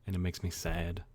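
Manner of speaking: normal talking voice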